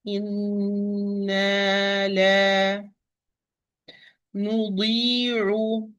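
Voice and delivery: Inna la nudi'u chanted very slowly, each mad held; Quranic recitation. Shown as a demonstration. Teacher recites the verse slowly for her student. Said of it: "Give it its time".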